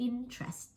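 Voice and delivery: INtrest, high first syllable, weakened vowel after; light later vowels. Shown as a demonstration. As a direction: say "interest" high on IN, soft afterwards. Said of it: "พยางค์ที่สอง สาม ก็จะแบบค่อนข้างเสียงสระเบาลง". (เน้นต้นคำ interest เสียงสระท้ายเบาลง)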